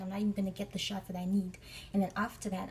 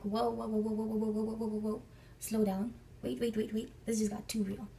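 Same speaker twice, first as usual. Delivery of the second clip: rapid-fire stream of whoas, then braking; retold stunned inner protest; alarmed disbelief. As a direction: put the brakes on, mock alarm. Acted alarm at jail revelation.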